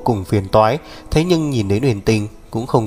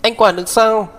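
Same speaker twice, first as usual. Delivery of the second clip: high, loud shouted comeback; loud retort; 11 semitones higher than usual. Acted. Sister shouting back at her scolding brother, loud. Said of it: "Tiền Tuyết giống to".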